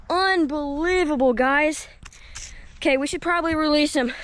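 ordinary talk, nothing marked

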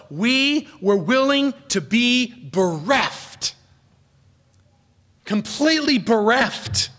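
"willing" and "Completely bereft" drawn out and pressed; slow emphatic phrase. Shown as a demonstration. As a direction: drive home "bereft" slowly. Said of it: "a term of deep and rich emotion".

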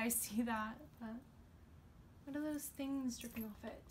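ordinary talk, nothing marked

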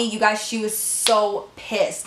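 ordinary delivery

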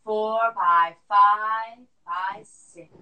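slow loud forced count; lung function test. Shown as a demonstration. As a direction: push the breath out counting. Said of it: "breath count drill".

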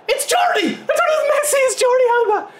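very high shouting, breaking into laughter; surprised ecstatic shout. Real, not acted. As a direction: yell in happy disbelief at the scorer. Surprised goal celebration.

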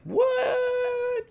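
very high, loud mock outcry; shocked shout. Gamer retelling the range bug, shouting in shock. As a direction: yelp in disbelief. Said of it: "I'm like".

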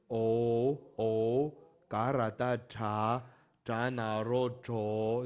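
drawn out letter by letter; slow spelling out. Shown as a demonstration. Teacher modelling the spelling for class, slow. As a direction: recite the spelling slowly. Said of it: "比如说，就刚刚那个".

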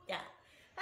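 ordinary talk, nothing marked

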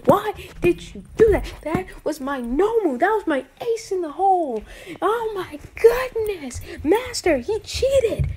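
put-on pouting child crying "cheater" to his master; whiny kid villain. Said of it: "freaking out, like, in a kid way".

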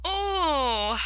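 high drawn-out groan; long groan. Acted. Coaches bumped rudely by the engine, groaning. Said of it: "groaned the coaches".

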